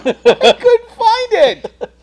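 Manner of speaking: loud, high, very slow wail; exasperated. Real, not acted. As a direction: whine it out in frustration. Frustrated exclaim.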